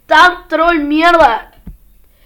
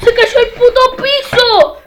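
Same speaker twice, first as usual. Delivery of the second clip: high panicked shout; panicked yell; 8 semitones higher than usual. Real, not acted. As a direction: cry out as the floor drops. Floor collapses, panics.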